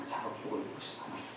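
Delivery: 작업복을 입으신 하나님 in a soft, fast whisper. Shown as a demonstration. Preacher whispers the book title to the congregation. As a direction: breathe the title back quietly, almost to yourself.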